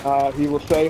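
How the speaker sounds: normal talking voice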